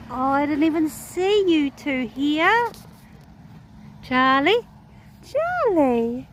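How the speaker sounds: high, loud, drawn-out greeting; sing-out excited pet call; delighted surprise. Real, not acted. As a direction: call out to horses you just spotted. Surprised, calling to horses.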